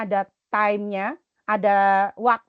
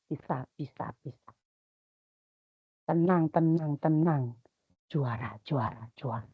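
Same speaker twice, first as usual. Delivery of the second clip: low, soft chant of reassurance; murmured encouragement; 6 semitones lower, 7 dB quieter than usual. Retold or imitated. Teacher calming a nervous student before competition, soft murmured chant. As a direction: murmur it like a charm into the ear. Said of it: "Saya kasih jampe-jampe".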